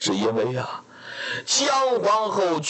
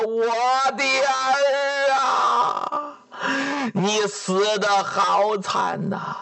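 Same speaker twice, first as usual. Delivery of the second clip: weep aloud for your lost daughter, slowly; wailing; 3 semitones higher, slower than usual. Acted. Father mourning his murdered daughter, wailing slowly. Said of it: "放声痛哭".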